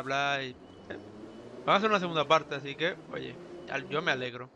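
usual speaking voice

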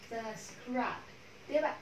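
ordinary talk

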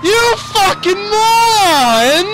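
furious slow yell. Real, not acted. Gamer yelling at his friend's terrible driving. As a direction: stretch every word in outrage. Friend flips car again.

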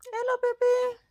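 put-on sing-song baby talk; cutesy greeting. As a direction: coo it like talking to a pet.

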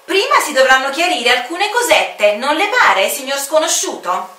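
insistent official voice; stern commander. Acted. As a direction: press the stranger politely but firmly. Commander questions the stranger.

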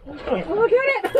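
high, excited shouting; frantic urging. Real, not acted. Angler as something chases the fish, frantic urging. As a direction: urge them on in a rising panic. Shark chasing the hooked fish.

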